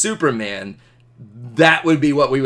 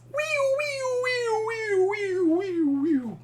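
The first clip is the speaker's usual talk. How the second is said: passing siren; 7 dB quieter, slower than usual. Shown as a demonstration. Teacher imitating a siren passing by, high then falling. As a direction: let the siren drop in pitch as it moves away. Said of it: "And when it passes".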